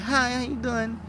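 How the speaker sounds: a light, put-on polite greeting; fake friendly hello. To the enemy, a mock friendly fake hello. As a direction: greet him sweetly but don't mean it. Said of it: "Give that fake persona".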